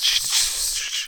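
long, repeated hissed shushes; shushing. Retold or imitated. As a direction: shush someone over and over. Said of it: "and just goes".

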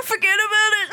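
strained, overdone Brooklyn voice; strained Brooklyn accent. Acted. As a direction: squeeze it out in thick Brooklyn. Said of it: "God, that is strained".

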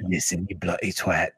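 demoing a better British accent to a friend. Shown as a demonstration. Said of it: "You gotta go way down low".

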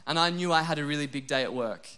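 ordinary talk

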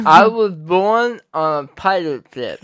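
laughing through a held tongue; slow, slurred, giggling with tongue pinched; holding tongue with fingers. Shown as a demonstration.

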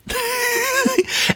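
sudden high-pitched laugh; high burst of laughter. Real, not acted. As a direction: cracks up in disbelief at the story. Laughing at the plot.

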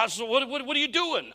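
high, loud, stammered demand; mock alarmed questioning; suspicious challenge. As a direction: confront them like a paranoid neighbor. Acts out suspicious person challenging another.

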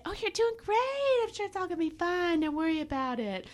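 high, airy mock reassurance; lightly mocking falsetto of false comfort; placating flatterer. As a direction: coo like someone who won't tell the truth. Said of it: "somebody never tell you and just say".

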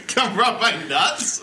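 laughing, slow and high. Real, not acted. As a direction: giggle through the words. Laughing joke line.

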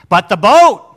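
loud outburst, voice jumping up; sudden shout. Real, not acted. Preacher jolting the congregation from the peaceful scene, sudden shout. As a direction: burst in loudly to shatter the calm.

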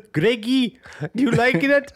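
put-on high, loud, mischievous old lady voice; grandma teasing Greg about her fart. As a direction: tease your grandson sweetly. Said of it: "she'll just go".

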